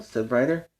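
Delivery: ordinary delivery, nothing marked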